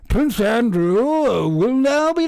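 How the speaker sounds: high, slow pompous proclamation; mock royal announcement. Reading the palace statement to viewers, pompous mock herald. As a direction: read it grandly like a herald.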